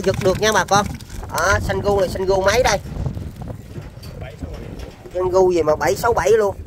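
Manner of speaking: ordinary talk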